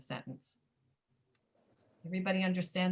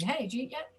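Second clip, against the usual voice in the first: fast American speech; 4 semitones higher than usual. Retold or imitated. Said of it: "Americans who speak fast, they will say".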